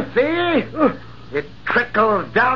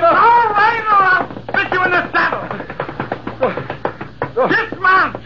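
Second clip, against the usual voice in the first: commanding battle shout; 7 semitones higher than usual. Acted. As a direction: roar him down off his horse in fury. Captain challenges his rival to duel.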